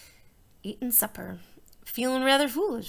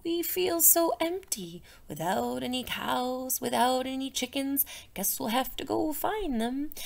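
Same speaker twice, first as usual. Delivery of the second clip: mild sad barns; 8 dB quieter than usual. Acted. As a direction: sigh it like an empty old barn. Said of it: "They said".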